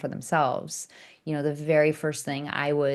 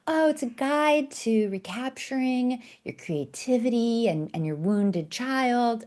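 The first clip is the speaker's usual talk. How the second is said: higher, earnest friend voice; imitating her friend; 6 semitones higher than usual. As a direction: mimic her friend's gentle sales pitch. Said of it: "She's like".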